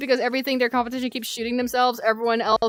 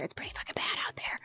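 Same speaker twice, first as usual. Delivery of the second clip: unvoiced whisper, fading away; 15 dB quieter than usual. Shown as a demonstration. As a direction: drop to a whisper on it. Repeats line, then whispers it.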